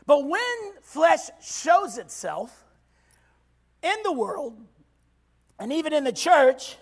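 ordinary talk, nothing marked

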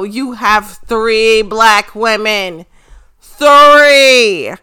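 loud, rising exasperated rant; heated rant. Real, not acted. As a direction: call WWE out loudly, building to a shout. Ranting at WWE about roster.

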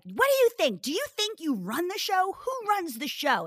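high-pitched mock scolding; scolding parent. Mother asking daughter who's boss, mock scolding. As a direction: challenge indignantly, pitched well up, question after question. Parent retelling challenge to child.

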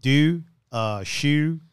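Baltimore fronted oo in each word; Baltimore accent. Shown as a demonstration. Showing how do is said in Baltimore, Baltimore accent. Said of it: "that's how you say it in Baltimore".

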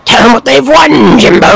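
high, manic cartoon villain voice; insane Scott Tenorman. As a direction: go unhinged like a cartoon maniac. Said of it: "line reads they do for insane version".

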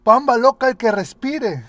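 loud high game call; shouting. Retold or imitated. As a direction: yell the new rule.

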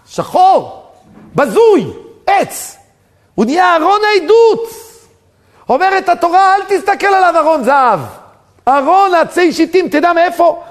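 high, loud, fervent sermon shouting; preaching at full force. Acted. Preacher exhorting listeners not to despair, loud fervent shouting. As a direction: cry it out to the congregation.

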